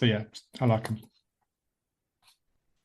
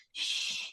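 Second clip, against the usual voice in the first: long, hushed "shh"; imitated shushing; 13 dB quieter than usual. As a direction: shush like the librarian in the scene. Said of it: "where the woman's like".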